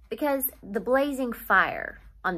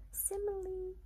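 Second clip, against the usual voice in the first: higher, much softer quick label, out of the story voice; teacher's aside; 10 semitones higher, 14 dB quieter than usual. Shown as a demonstration. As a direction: drop in the term like a quiet classroom note. Teacher names figure of speech.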